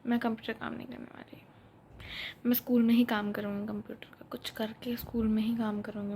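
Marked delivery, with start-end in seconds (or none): 0.1-1.4 quick unvoiced whisper; hurried hushed aside; fast whisper. Real, not acted. Quietly muttering plans at night. 3.7-6.1 same words, now unvoiced whisper; whispered restatement. Real, not acted. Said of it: "repeated in a whisper".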